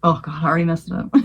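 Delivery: ordinary delivery, nothing marked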